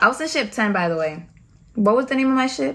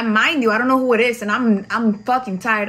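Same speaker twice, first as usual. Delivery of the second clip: ordinary delivery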